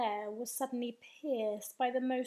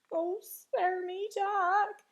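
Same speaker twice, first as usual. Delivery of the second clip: higher, slow wail for mercy; yelled plea; 6 semitones higher, 5 dB louder, much slower than usual. A narrator's reading. Giant begging Jack to spare him, wailing yell. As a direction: plead loudly, drawing it out. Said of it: "he yelled".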